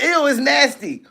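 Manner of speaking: high mock-disgusted mom voice; imitated disgusted mom.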